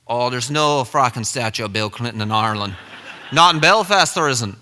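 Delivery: dismissive Irishman; imitated Irish scoffing; Irish accent. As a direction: flatly contradict in a put-on Irish accent. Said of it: "this accent".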